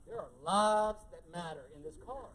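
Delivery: ordinary delivery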